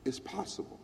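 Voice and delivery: breathy whisper, soft; quiet whispered reflection. Real, not acted. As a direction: hush it, awe. Solemn reflection.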